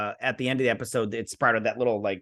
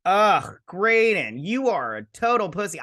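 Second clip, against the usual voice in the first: exasperated jab; 6 semitones higher, 4 dB louder than usual. Real, not acted.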